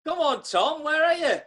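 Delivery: high, loud call. Real, not acted. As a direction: call out to him over the line. Calling out to frozen caller.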